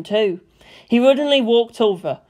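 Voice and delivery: ordinary delivery, nothing marked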